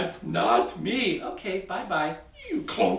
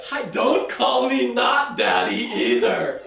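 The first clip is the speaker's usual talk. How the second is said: raised, louder objection; exasperated; 6 semitones higher, 5 dB louder than usual. Acted. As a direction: push back in frustration. Frustrated Willie objects.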